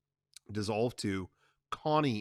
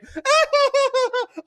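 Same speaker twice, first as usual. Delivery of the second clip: much higher, loud jeering laugh; mocking shriek laugh; 10 dB louder than usual. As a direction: laugh in her face.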